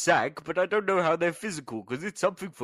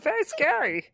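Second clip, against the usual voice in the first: higher, mock German accent; 13 semitones higher than usual. Guest mocking Nazi designers, hammy German accent. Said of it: "I love your German accent".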